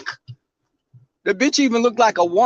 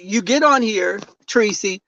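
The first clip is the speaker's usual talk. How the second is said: ordinary talk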